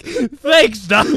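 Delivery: sarcastic thanks through a laugh; mock gratitude, giggling. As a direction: thank him with a laughing eye-roll. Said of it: "I'm just like".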